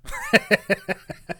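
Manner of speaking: squealing laugh; high squeal laugh. Real, not acted. High laugh after quote.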